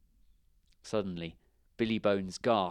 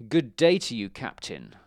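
growl; 2 semitones higher, 8 dB louder than usual. A narrator's reading. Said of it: "growled the stranger".

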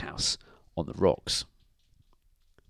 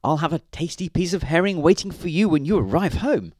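high, quick coaxing wifely voice; high-pitched comforting promise; consoling; 8 semitones higher, 4 dB louder, faster than usual. A narrator's reading. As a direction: cheer up the cat in a woman's voice. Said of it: "said Missus Grinling consolingly".

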